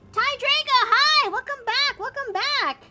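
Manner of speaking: high, loud, delighted hello; bubbly high-pitched welcome; excited greeting. Real, not acted. Greets a returning viewer.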